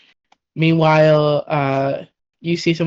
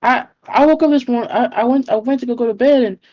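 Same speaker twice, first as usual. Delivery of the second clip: frazzled rant; 6 semitones higher than usual. Acted. Persephone keeps ranting frazzled.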